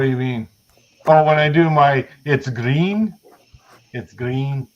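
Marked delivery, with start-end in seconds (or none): 2.3-4.7 put-on light Liverpool accent on it's green. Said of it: "that's my Liverpool accent".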